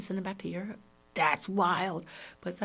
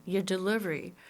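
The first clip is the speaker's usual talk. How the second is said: normal talking voice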